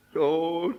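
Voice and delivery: drawn-out imitated moan of the name; mock crying. Widow at the grave calling her husband, mock crying. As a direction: stretch the name into a pretend sob. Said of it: "John nangis lagi".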